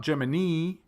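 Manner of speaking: Germany as juh-muh-NEE; long stressed NEE in Germany; wrong last-syllable stress. Shown as a demonstration. Teacher showing another mistake, Germany said juh-muh-NEE. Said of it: "and it's not".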